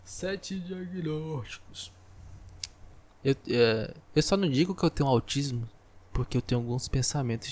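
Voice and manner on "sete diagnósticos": slow deadpan echo. Acted. Repeating joke slowly for effect.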